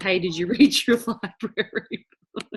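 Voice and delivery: real laughter breaking into the quote; giggling through the words; laughing. Real, not acted. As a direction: repeat the line while cracking up. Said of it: "I'm cracking up".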